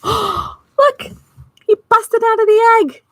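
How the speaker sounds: high, loud thrilled exclaim; excited. Real, not acted. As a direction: gasp and cheer at the surprise. Gasps at the hatching.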